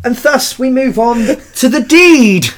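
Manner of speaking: mock grand announcement.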